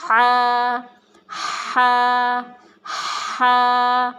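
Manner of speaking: airy ہا pushed from the upper throat; breathy ha. Shown as a demonstration. Teacher modelling ہا from the throat for children. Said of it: "گلے کے شروع والے حصے سے نکالیں گے".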